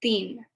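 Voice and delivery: put-on "teen" begun with a soft dental th, not t; "teen" with a th, like thin. Shown as a demonstration. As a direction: model "teen" with the tongue on the teeth. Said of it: "So three is".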